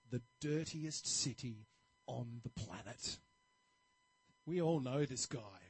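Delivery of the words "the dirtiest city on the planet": low, slow, dismissive sneer; lightly voiced grumpy barber; sour barber. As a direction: drag it out with disgust. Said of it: "really negative sort of outlook".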